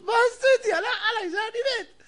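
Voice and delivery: high, hammed-up wailing with a laugh in it; mock crying. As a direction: whine it out like a comic sob. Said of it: "ומתחיל לבכות".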